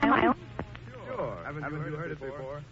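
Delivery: thin, far-off returning voice; distant echo. Real, not acted. Echo of the voices returning over two thousand miles, far off. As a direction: voice coming back over long wire. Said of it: "That little echo you heard".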